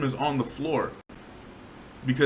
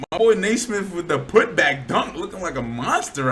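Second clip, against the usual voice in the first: loud, higher-pitched highlight call; hyped up; 3 semitones higher, 5 dB louder than usual. Real, not acted. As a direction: cheer on the big play. Hyped replay of monster dunk.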